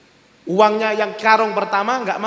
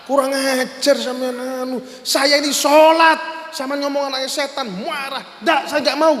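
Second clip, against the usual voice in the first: acted angry; 5 semitones higher than usual. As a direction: protest furiously as the insulted worshipper. (marah tersinggung, nada tinggi dan mengulur)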